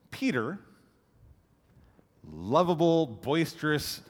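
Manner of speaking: ordinary delivery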